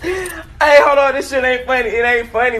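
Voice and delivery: loud, high protest easing off; mock protest. Storyteller telling chat it ain't funny, loud mock protest. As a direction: shout it in mock offence, then give in.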